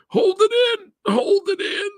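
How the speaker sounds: very high, loud squeal; mock desperate self-command. Coaching himself through the Superman scene, comically high panicked squeal. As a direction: squeal it like you're straining against tears. Mimics fighting tears at film.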